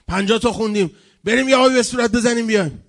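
loud, high call for a break; acted announcement. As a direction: holler it to the whole congregation. Acted worn-out prayer crowd's leader announcing. (با صدای بلند اعلام استراحت)

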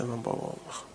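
faint whispered plea. Shown as a demonstration. Preacher voicing a child who misses his father, whispered. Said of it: "repeated softer".

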